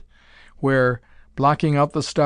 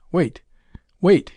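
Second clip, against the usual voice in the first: second Wait much higher and louder; sharp order; 4 semitones higher, 4 dB louder than usual. A narrator's reading. Captain silencing the operator, a loud sharp order. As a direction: bark the order to hush them. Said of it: "he ordered".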